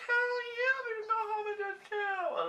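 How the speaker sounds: very high excited squeal; over-the-top thrilled reply; eager agreement. Retold or imitated. Acting out eager yes to boyfriend.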